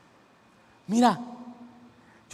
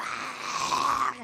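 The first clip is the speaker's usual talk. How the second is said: put-on possessed girl screaming; 3 semitones higher, 3 dB quieter, much slower than usual. Pastor imitating the possessed girl's mock shriek. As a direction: act out the possessed girl yelling. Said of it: "empezara a patolear y gritaba".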